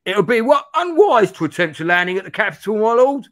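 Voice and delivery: high, loud, agitated character voice; worried adviser. Acted. Friend cautioning his lord against landing, high alarmed voice.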